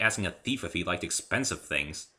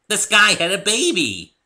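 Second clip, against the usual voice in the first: high, loud and slow, bursting with wonder; a giddy pony marvelling at something; excited Pinky; 14 semitones higher, 14 dB louder, slower than usual. A narrator's reading. Pinky telling Rarity about the sky, high and excited.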